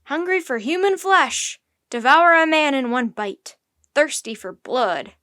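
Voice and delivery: loud mob cries; forceful alarmed exclamations; shouting. A narrator's reading. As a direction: shout it like a frightened, hostile crowd. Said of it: "everyone kept shouting".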